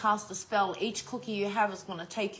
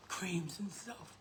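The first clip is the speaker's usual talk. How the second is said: whispered; 4 semitones lower, 13 dB quieter, slower than usual. Retold or imitated. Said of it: "They probably thought".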